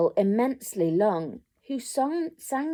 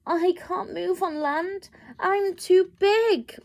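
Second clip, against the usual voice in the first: moaning whale; 8 semitones higher, 4 dB louder than usual. A narrator's reading. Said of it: "moaned the whale".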